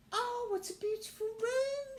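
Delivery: very high, wondering doll voice; amazed doll. Acted. Ruby seeing the room, very high and amazed. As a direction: marvel at it tearfully. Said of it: "she said".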